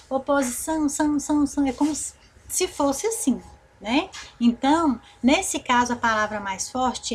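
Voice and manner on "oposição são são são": "oposição" with SÃO repeated like an echo; echoed final syllable. Shown as a demonstration. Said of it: "É como se nós repetíssemos".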